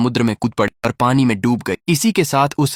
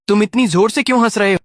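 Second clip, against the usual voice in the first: high, louder, fast irritated question; irritated demand; 9 semitones higher, 4 dB louder, faster than usual. A narrator's reading. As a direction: snap at him, annoyed he keeps laughing. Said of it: "राक्षसी ने चिढ़कर पूछा".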